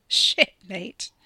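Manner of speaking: giggly loud burst; giggling. Acted. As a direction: laugh the words out. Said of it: "Ricky giggled".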